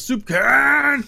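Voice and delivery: high, loud battle cry; yelling. Acted. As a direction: bellow his own name. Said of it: "And he yells".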